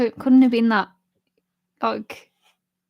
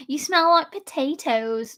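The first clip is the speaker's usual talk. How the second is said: slow, carefully enunciated posh voice; posh accent; 3 semitones higher, slower than usual. Acted. Mimicking her sister's insult, slow posh accent. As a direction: put on a posh accent, each word measured. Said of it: "she'd say it posh out".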